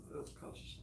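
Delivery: whispered, half to herself; repeating the culture shock in a whisper; whispered aside. Real, not acted. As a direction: breathe it out under the breath. Repeated under her breath.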